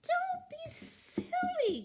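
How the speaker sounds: very high, drawn-out goat protest; high cry. A narrator's reading. Middle goat brushing off the troll's threat, high-pitched cry. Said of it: "cried Middle Gruff".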